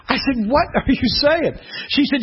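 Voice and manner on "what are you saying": high, disbelieving question; high-pitched baffled retort; mock incredulity. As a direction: act out being utterly lost. Retells his baffled reply.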